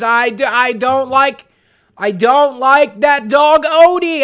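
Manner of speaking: loud, high, slow cartoon cat wail; hammed-up whining cat calling for his owner; Garfield impression. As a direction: stretch every vowel and bellow it. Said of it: "guess Garfield there".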